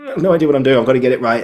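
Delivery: rattled, desperate to get it right; nervous and anxious. Retold or imitated. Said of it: "I went in like this".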